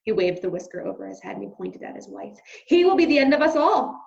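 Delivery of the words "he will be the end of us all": loud high angry father; loud high shout of dismay. Acted. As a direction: bellow it in dismay. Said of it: "he shouted".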